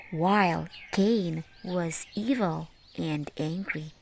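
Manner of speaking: ordinary delivery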